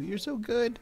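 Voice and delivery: high cooing praise for a pet; pet-talk praise. Real, not acted. Praising his little golem in a high pet voice. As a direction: coo it like you're praising a puppy.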